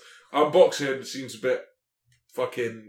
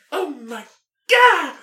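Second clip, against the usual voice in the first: put-on high, squeaky anime girl squeal; 13 semitones higher, 6 dB louder, much slower than usual. Describing an anime clip to friends, high mock anime squeal. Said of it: "it's like an anime girl".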